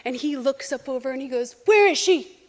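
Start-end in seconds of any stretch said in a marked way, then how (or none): 1.7-2.4 louder, higher call of Where is she, then a louder I know she's out there; retold loud call. Pete at the door at night, calling out loudly. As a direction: shout it into the dark as if you've caught them.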